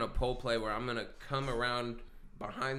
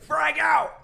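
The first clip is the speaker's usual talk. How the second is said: much higher, loud yelled battle cry; military shout; 14 dB louder than usual. Retold or imitated. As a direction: yell it like a soldier throwing a grenade. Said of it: "he would go".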